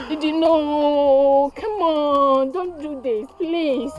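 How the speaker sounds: grieving plea. Acted. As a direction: stretch out the no in despair. Mother's final plea.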